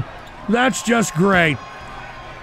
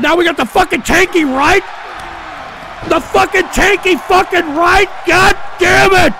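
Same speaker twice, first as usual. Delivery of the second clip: furious outburst; 6 semitones higher than usual. Real, not acted. Streamer cursing at chat over the tanky, furious. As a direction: explode at it.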